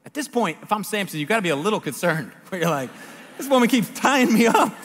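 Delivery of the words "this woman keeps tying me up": high, loud mock bewilderment; comic alarm. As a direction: blurt it out as an incredulous protest. Voices Samson's alarm as a joke.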